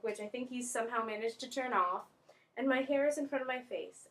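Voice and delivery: ordinary talk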